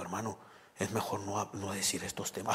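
low, hushed imitation of a cautious minister. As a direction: drop pitch and volume confidentially. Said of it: "Hasta bajó la voz".

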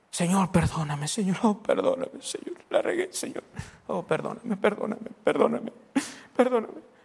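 hammed-up, breaking begging for forgiveness; mock pleading. Pastor imitating a guilty believer praying at night, mock desperate pleading. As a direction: plead over and over, overdone.

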